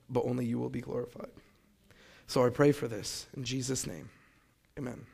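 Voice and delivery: soft, hushed prayer voice. Acted. Speaker opening his testimony with a quiet, humble prayer. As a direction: pray quietly and humbly to God.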